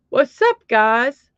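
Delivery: high, loud, hyped "What's up, guys?"; reading viewer's greeting. Retold or imitated. Reading out a viewer's chat greeting to the stream, high and hyped. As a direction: pop the greeting up high and loud like a hype kid. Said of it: "Stunning asks".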